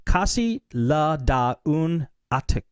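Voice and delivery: "Casi la da un" spelled out slowly, syllable by syllable as in Spanish; slow, careful foreign read. Shown as a demonstration. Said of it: "A T A Q U E".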